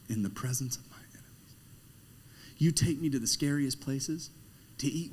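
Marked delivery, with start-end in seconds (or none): 0.1-1.5 a whispered line of scripture; whispered verse. Acted. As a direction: breathe the words like a secret. Psalm line whispered after pause.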